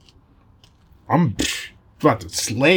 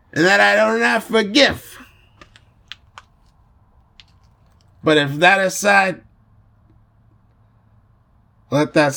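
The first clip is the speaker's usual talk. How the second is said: solemn oath in put-on Italian accent. Godfather swearing to keep peace, Italian accent. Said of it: "but in an Italian voice".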